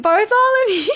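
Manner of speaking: high, loud call across the street. Pretend. Owner searching for lost dog at night, calling out loud and high. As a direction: yell the dog's name into the night.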